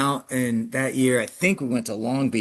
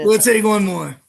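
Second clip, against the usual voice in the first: higher, louder acted-out eagerness; excited; 9 semitones higher, 7 dB louder than usual. Coach guiltily admitting he pushes for another jump, mock excited. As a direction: play the coach caught up in the moment. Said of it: "you get excited".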